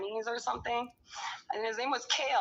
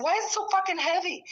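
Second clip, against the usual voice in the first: higher and fast, a retold outraged question; exasperated outburst; 9 semitones higher, 3 dB louder, faster than usual. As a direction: fire it off in disbelief. Retelling finding the heavy bag.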